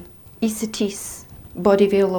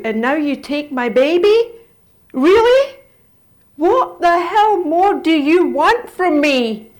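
much higher and louder, slow, cracking with rage; desperate scream; 7 semitones higher, 7 dB louder, slower than usual. Retold or imitated. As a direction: scream it at the sky. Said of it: "I screamed".

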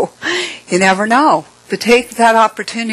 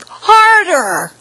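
much higher, louder one-word order; bossy demand; 12 semitones higher, 4 dB louder than usual. Retold or imitated. Old woman orders the narrator to scratch harder, higher and louder. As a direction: bark it like a pushy patient. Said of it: "she goes".

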